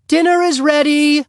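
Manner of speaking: very high, loud mealtime call. A narrator's reading. Mother calls the family to dinner, calling above bedlam. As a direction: call out over a noisy room. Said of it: "called out above the bedlam".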